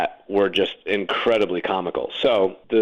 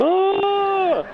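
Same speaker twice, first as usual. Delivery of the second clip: long, high-pitched "oh" scream; re-enacted scream. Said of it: "and I scream".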